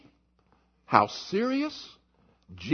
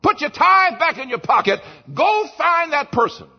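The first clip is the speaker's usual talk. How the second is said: high, loud command to get up; urgent shouted command from the pulpit; 8 semitones higher, 6 dB louder than usual. Acted. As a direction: order them out of church at full force. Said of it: "he says".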